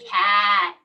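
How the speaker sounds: usual speaking voice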